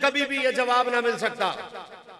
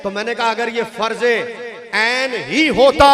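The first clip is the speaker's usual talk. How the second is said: पुरज़ोर ख़िताबत; 12 dB louder than usual. Real, not acted. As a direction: drive the point home at full force, stretching key words. Argues at full force. (बुलंद आवाज़ में ज़ोरदार दलील)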